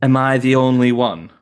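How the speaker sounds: Yorkshire accent with rounded vowels; northern Yorkshire accent. Shown as a demonstration. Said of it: "if we go to Yorkshire ... So it'll be more like".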